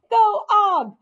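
high, loud urging; yelled cheer. A narrator's reading. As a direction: cheer on a galloping mount. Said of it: "I yelled".

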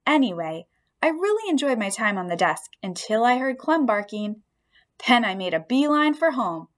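plain reading voice

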